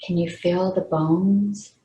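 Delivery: unhurried meditation guide, pausing between phrases; guided meditation. Real, not acted. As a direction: lead them gently into relaxation, leaving long pauses. Leads a meditation.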